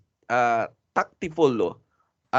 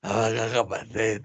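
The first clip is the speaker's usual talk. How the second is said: lost voice; 6 semitones lower than usual. Retold or imitated. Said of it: "Nawala na yung boses mo".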